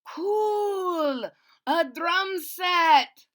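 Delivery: high, loud, thrilled exclaim; delighted kid spotting a drum set; excited. Acted. Kids thrilled by instruments.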